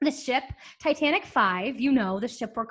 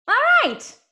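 squeaky all right; 13 semitones higher, 6 dB louder than usual. Acted. Repeated all right takes.

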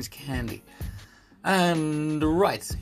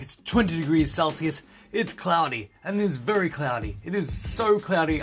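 ordinary talk

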